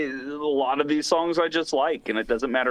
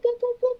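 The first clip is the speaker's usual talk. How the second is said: squeaky whoops, pitched way up; high whoop sounds; faster than usual. Acted. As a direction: chirp each whoop high. Imitating a sound effect.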